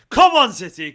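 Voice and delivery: loud rallying cheer for City; cheering shout. Real, not acted. Fan signing off, cheering his team loudly.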